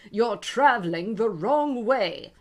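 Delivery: loud stern guard; guard tells Alice she is going the wrong way; guard's verdict. Acted.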